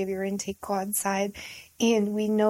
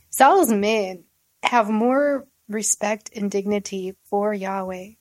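ordinary delivery

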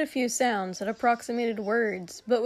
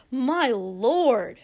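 louder, scandalized exclamation; shocked gasp; 5 dB louder than usual. A narrator's reading. Servant shocked by the prince's curse, gasping. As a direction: exclaim it, aghast at the language. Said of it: "Ida gasped".

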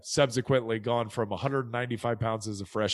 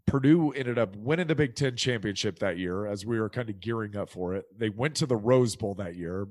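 usual speaking voice

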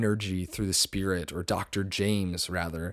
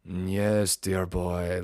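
lightly put-on English accent; mock old-time English accent; English doctor impression; 2 semitones lower, much slower than usual. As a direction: imitate a medium channeling an Englishman. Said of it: "stops talking normally, and she goes".

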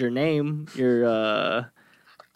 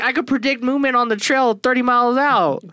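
high boastful voice; hyped-up bragging; 9 semitones higher, 3 dB louder than usual. Retold or imitated. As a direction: brag it high and loud. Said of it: "He's like".

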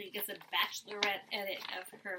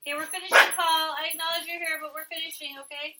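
raised, higher voice at the dog; firm call to the dog, louder; louder, higher word to Paul the dog; scolding the dog; 6 semitones higher, 6 dB louder, faster than usual. Real, not acted. Telling the dog to wait.

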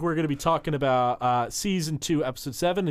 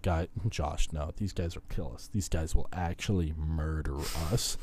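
low, hushed caution; soft, low-pitched acted warning; worried warning; 9 semitones lower, 7 dB quieter than usual. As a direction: warn a friend under your voice. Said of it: "And [name] is like".

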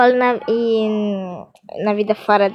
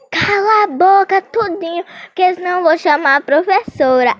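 mock yell; 7 semitones higher than usual. Girl yelling at noisy boys.

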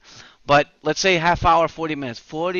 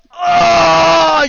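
sudden high yelled "Oh"; hammed-up pained shout; mock anguished cry; 11 semitones higher, 12 dB louder than usual. Acting out desperate moment on train.

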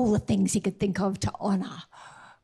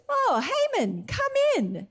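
high, bright royal welcome; a king calling his favourite in; king welcoming Haman; 11 semitones higher, slower than usual. Retold or imitated. King greets Haman, high and eager.

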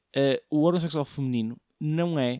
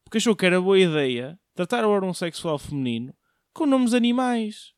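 usual speaking voice

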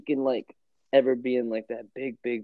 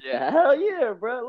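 hyped agreement; 12 semitones higher, slower than usual. Retold or imitated. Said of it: "He said".

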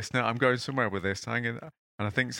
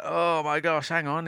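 groggy uncle; 8 semitones higher, 3 dB louder than usual. Retold or imitated. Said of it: "Uncle's like".

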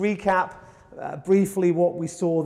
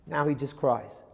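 sad; 5 semitones lower, 6 dB quieter than usual. Shown as a demonstration.

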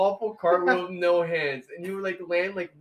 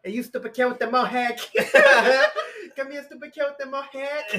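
higher mocking shout across the room with laughter; mock shouted call; 8 semitones higher, 6 dB louder than usual. Friend Chow calling across the cafeteria, high mock shout. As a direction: yell at the kid with the mohawk, half laughing. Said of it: "And he's like".

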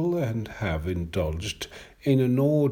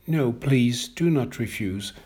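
ordinary delivery

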